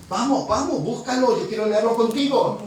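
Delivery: más alto y agudo, urgiendo a buscar el texto; exhortación enérgica. Real, not acted. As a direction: anima a la congregación a abrir la Biblia. (exhortación fuerte y aguda a buscar el versículo)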